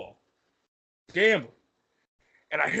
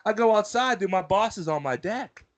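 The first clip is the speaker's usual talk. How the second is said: ordinary talk, nothing marked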